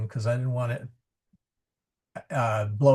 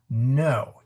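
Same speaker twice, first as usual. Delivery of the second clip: ordinary talk, nothing marked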